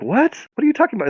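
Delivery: high-pitched baffled squeak; incredulous. Acted. Woman hears she needs a companion, incredulous. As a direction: let the voice leap up in acted disbelief.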